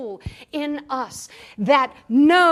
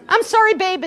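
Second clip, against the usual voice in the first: a brighter, louder "I'm sorry, babe" with weight on "sorry"; sweet apology; 5 semitones higher, 7 dB louder than usual. Retold or imitated. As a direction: sell "I'm sorry, babe" as the nice everyday fix. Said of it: "is nice".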